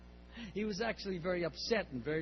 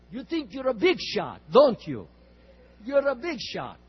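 put-on loud accusing Russian accent; 5 semitones higher, 13 dB louder than usual. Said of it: "strong Russian accent".

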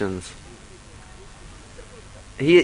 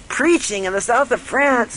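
higher, slow disbelieving question; incredulous; 10 semitones higher than usual. Retold or imitated. Disciple balks at preaching in France, disbelieving. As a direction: play the stunned disciple, much higher.

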